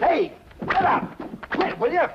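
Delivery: high, slowed cries to be let go; panicked yelp. Acted. Stranger being thrown out by the barber, high panicked cries. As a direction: plead frantically as you're thrown out.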